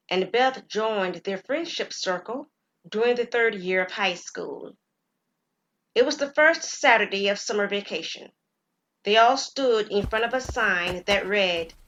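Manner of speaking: usual speaking voice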